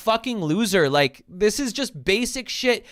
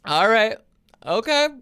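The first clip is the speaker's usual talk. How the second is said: higher, mock-agreeable brush-off; sarcastic okay; dismissive; 4 semitones higher than usual. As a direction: humor him and move on. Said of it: "I'm like".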